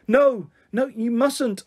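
high-pitched, urgent objection; alarmed protest. A narrator's reading. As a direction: beg her to stop, voice jumping up high. Child protests against Mum phoning.

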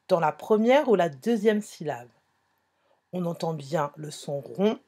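ordinary talk, nothing marked

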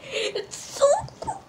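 soft high whimper; crying from cold. Real, not acted. Holding snow, cries out.

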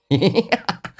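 high burst of laughter; high-pitched hearty laugh; unrestrained laugh. A narrator's reading. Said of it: "放声大笑".

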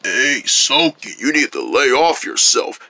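deep, laid-back scolding; new low voice. Acted. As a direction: chide a friend in a put-on low voice. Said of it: "why do you sound like that?".

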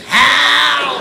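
high exaggerated pidgin yelp; Portuguese pidgin cry. Shown as a demonstration. Comedian coaching the volunteer, high exaggerated pidgin yelp. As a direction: ham up the Portuguese lady's yell. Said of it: "real Portuguese pigeon".

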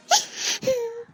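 high sobbing wail, breaking down; sobbing cry. Acted. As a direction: let out a choked sob. Daughter sobs. (ಬಿಕ್ಕಿ ಅಳು)

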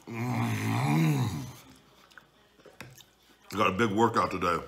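talking between bites; mumbling around food; chewing with mouth full. Real, not acted. Seen 2: Takes big forkful at -1.0, jaw chewing, closed mouth through words.

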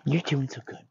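lightly voiced princess praise; a hint of princess sweet-talk to a dog. Shown as a demonstration. Trainer praising a dog, light princess voice. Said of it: "these extra princess voices that I'm doing".

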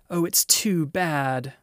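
narrator's understated sob, drawn out; mock sobbing. A narrator's reading. Lucy at the table finding the body gone, mild sob. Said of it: "sobbed Lucy".

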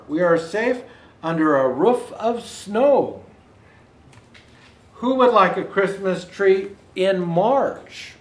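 plain reading voice

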